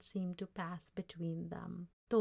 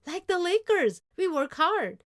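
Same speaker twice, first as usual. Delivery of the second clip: put-on high, loud boyish boast; proud excitement; 10 semitones higher, 10 dB louder than usual. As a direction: crow it like a proud kid. Boy boasts with a slam dunk.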